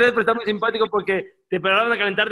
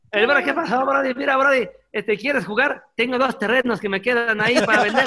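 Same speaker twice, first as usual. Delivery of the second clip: put-on Acapulco accent; 2 semitones higher than usual. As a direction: play a beach-town salesman, Acapulco style. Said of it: "cómo hablan los acapulqueños". (acento acapulqueño de vendedor)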